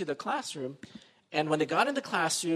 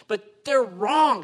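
defiant declaration; 5 semitones higher, 8 dB louder, much slower than usual. Retold or imitated. Missionary's quote, defiant declaration.

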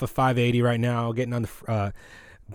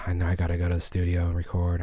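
burnt out mumble; 6 semitones lower, 7 dB quieter than usual. Retold or imitated. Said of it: "I'm just burnt out".

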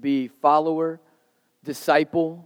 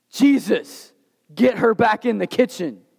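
mock complaint; 5 semitones higher than usual. Martha complains to Jesus.

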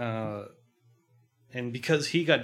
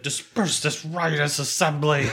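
laughing imitation; 4 semitones higher, 6 dB louder than usual. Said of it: "but he does that".

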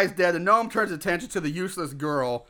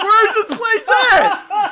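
high, loud and slow puzzled question; mock lost Chuck; 11 semitones higher, 8 dB louder, much slower than usual. Chuck asking for directions, high, loud, slow. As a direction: drag it out like you're hopelessly lost.

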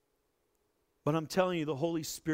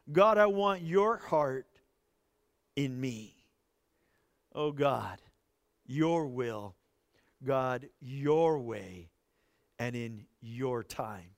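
quiet surrender prayer; 3 semitones lower, slower than usual. Acted. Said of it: "Say, "Father".

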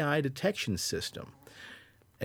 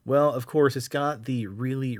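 ordinary talk

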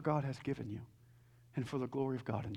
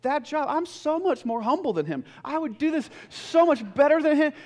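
raised, louder voice, protesting to God; frustrated complaint; 9 semitones higher, 8 dB louder than usual. Retold or imitated. Seminary student to God over another man's job, frustrated. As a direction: complain at higher pitch, with indignant force. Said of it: "I used to get so frustrated and say".

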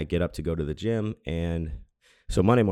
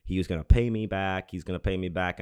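ordinary talk, nothing marked

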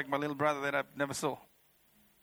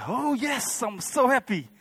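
high, gleeful burst of delight; overjoyed; 7 semitones higher, 4 dB louder, slower than usual. Retold or imitated. Told by Jesus they can go, overjoyed. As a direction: crow it with happy relief.

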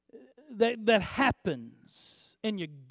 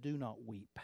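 soft command; 10 semitones lower, 15 dB quieter than usual. Acted. Jesus's words said softly.

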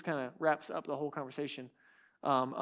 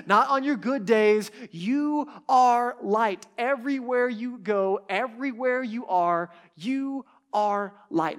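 raised, higher voice, hammering each "not"; emphatic preaching; 4 semitones higher, 6 dB louder than usual. Real, not acted. Preacher driving home the congregation's identity, forceful and emphatic. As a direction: build to a loud, drawn-out declaration.

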